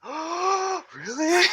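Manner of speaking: high-pitched fake astonished gasp; mock amazement. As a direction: react as if stunned by trivia. Mocks an amazed viewer's reaction.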